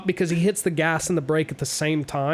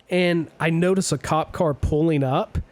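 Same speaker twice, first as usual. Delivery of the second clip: ordinary talk, nothing marked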